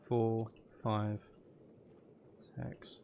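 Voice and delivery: normal talking voice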